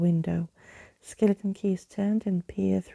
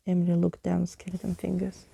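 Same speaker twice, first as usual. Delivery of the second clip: plain reading voice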